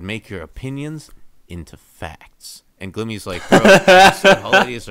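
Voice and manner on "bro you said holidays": loud quote broken by laughter; laughing. Real, not acted. Glimmer's quote to Twilight, laughing loudly. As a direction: laugh through the line.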